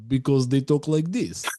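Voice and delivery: a light put-on Russian accent. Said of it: "That's actually pretty good accent".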